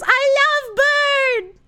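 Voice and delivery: slow, high, laugh-cry shout. Real, not acted. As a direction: wail it while cracking up.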